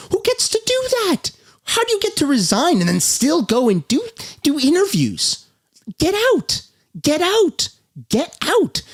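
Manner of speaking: real high-pitched exasperated rant; pissed off. Real, not acted. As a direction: let the outrage push your pitch way up. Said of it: "Sorry for being all pissed off".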